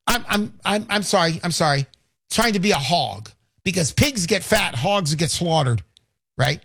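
ordinary talk, nothing marked

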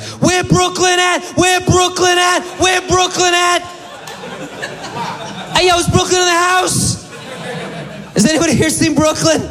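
mock search-party yell; hollering for someone. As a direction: holler it like a hype chant, way up high. Imagined calling out for missing Brooklyn.

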